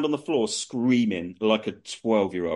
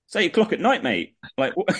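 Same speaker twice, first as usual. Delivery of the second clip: normal talking voice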